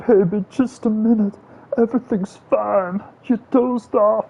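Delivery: very high, slow, cooing voice to a loved one; sweet soothing baby talk. Pretend. Gamer cooing at his viewer as if waking a partner, high sugary baby voice. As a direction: coo it like soothing a sleepy partner. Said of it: "Imagine if somebody talked to you like that".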